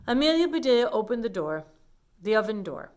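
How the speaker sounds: ordinary delivery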